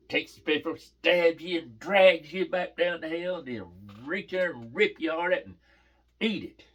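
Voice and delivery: high, loud, hammed-up retelling with stretched verbs; child's scary tale. Daughter repeats the devil story for Daddy, hammed-up scary tale. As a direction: relish every gory verb like a kid storyteller.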